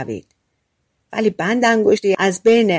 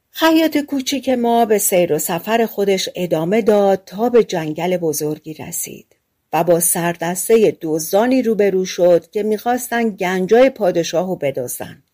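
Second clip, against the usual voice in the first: ordinary delivery, nothing marked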